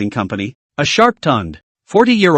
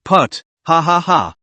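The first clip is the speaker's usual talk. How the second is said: laughing. A narrator's reading. Netizen bursts out laughing.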